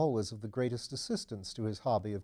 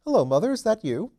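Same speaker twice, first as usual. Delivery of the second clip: phone greeting; 10 semitones higher, 6 dB louder, faster than usual. Acted. Greeting mother on telephone.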